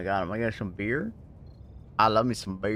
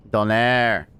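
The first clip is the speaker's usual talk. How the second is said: usual speaking voice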